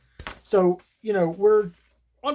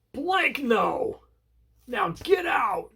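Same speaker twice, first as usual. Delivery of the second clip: higher, slow mock shout of a furious pastor; angry pastor; 7 semitones higher, much slower than usual. Pastor throwing the heroes out of his church, hammed-up angry shout. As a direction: bark him out of the church, hammed-up. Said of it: "And he goes".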